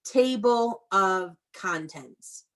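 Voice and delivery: slow, clear teacher model; model word to repeat. Shown as a demonstration. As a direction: say it slowly for kids to echo. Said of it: "Say this with me".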